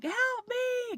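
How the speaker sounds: very high, drawn-out mock plea; retold panicked yell. Patient calling for help in recovery, mock panicked yell. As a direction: imitate a panicked call without real volume. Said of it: "I was just panicking".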